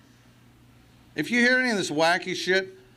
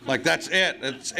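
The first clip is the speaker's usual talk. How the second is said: normal talking voice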